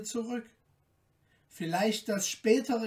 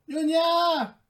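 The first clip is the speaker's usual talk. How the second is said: high, louder grudging call; impatient call; 10 semitones higher, 5 dB louder than usual. A narrator's reading. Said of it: "riefen sie".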